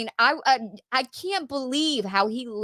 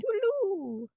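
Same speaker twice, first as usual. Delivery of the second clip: high, softer falsetto echo; echoed singsong farewell; 12 semitones higher, 6 dB quieter, slower than usual. Retold or imitated. Partner echoes the co-host's Doubtfire joke, high singsong. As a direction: sing it back high and light.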